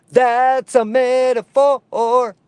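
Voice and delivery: hammed-up slow "That's a metaphor."; drawn-out emphatic repeat. Repeats the same words with emphasis.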